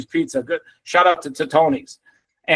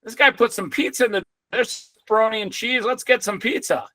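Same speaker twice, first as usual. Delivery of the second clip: lightly voiced rats, a touch higher; 6 semitones higher than usual. Rats discovering pizza left in doorways, lightly voiced rat squeak. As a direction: play the rats as sneaky little schemers, pitched up a bit. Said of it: "the rats realized".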